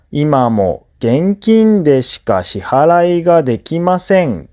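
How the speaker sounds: ordinary delivery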